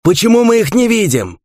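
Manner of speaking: very high, loud, distressed question; desperate cry. A narrator's reading. Fighter to comrades facing invisible beasts, desperate cry. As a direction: cry out in helpless alarm.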